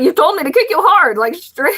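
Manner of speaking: high, exasperated disbelief; retold exasperated reply. To her brother, a high exasperated retort. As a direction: squeal it back at him in disbelief. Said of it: "And I was just like".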